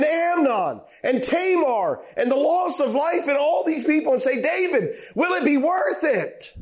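high, ringing preacher's call; preaching at full force. Acted. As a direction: build to a fervent plea to David.

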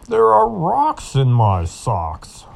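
slow, loud grumbling ox. Acted. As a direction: drag the words out like a grumpy ox. Ox complains in story.

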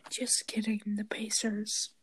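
soft unvoiced whisper. Real, not acted. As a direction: whisper it under your breath. Kids whispering NBA picks.

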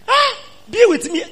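very high whining plea; high, loud begging woman; pleading wife. Acted. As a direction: beg him, voice way up.

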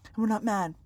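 disappointed mom voice. Acted. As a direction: sigh it out like a let-down mom. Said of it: "I can do the disappointed mom voice".

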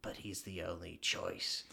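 low, hushed god's voice, fading to a slow whisper; Raiden. Retold or imitated. Fan retelling the god's reply to the grandfather, hushed low imitation. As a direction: imitate a grave lightning god, soft and low. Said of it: "Raiden's like".

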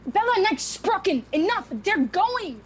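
stern mother yelling at daughter; angry shout. Acted. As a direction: shout her down. Grandmother shouts down her daughter.

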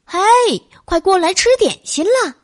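mildly loud, high-pitched summons; calling out. A narrator's reading. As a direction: call the children over for a snack. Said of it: "贝贝小姐大声说".

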